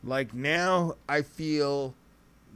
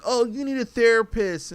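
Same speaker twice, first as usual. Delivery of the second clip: mocking; 7 semitones higher, 4 dB louder than usual. Mocking what rappers push.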